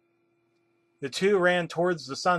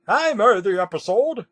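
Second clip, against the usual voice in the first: loud, raised-pitch confession; proclaiming; 7 semitones higher, 7 dB louder than usual. A narrator's reading. An old intern owns up to the episode, proclaiming. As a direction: proclaim it to the whole room. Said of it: "then stood up and proclaimed".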